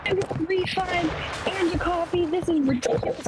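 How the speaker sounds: high-pitched imitation of an irate customer; retold yelling. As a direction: act out an outraged complaint in a high voice. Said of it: "they start yelling at each other".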